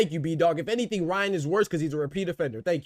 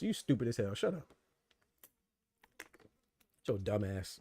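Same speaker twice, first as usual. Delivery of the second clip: low, much softer put-down; muttered insult; 7 semitones lower, 9 dB quieter than usual. Real, not acted. Streamer muttering insults at a chat viewer, low and soft. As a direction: mutter it under your breath.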